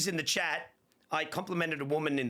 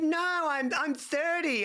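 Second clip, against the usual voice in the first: high imitated woman; 12 semitones higher, 3 dB louder, slower than usual. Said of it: "She said".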